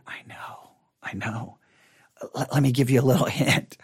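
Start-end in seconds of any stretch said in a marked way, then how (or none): 0.1-1.6 hushed, whispered sympathy; very soft, teasing whisper; mock-sympathetic whisper. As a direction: whisper it as if consoling someone. Mock sympathy for shocked listener.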